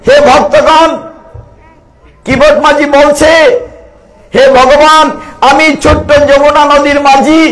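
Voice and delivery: high, loud impassioned sermon; boatman's tearful plea, preached loud; preaching at full force. Acted. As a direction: cry it out to the devotees.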